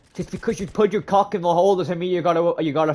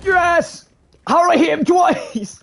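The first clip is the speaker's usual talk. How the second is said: much higher and louder amazed yell; excited shout; 6 dB louder than usual. Real, not acted. Gamer yelling in surprise at his own double hit. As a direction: shout it out in disbelief.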